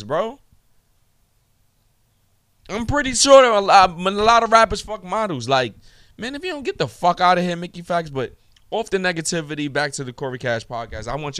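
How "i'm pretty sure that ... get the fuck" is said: higher and louder, incredulous shouting; loud rant. Real, not acted. Podcaster mocking a rapper's brag about models, loud incredulous rant. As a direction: yell it like it's obvious. Ranting at [name].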